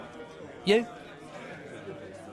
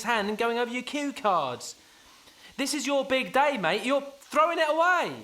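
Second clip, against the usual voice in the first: pleading pep talk; 6 semitones higher than usual. Acted. Best man pleads with sick groom.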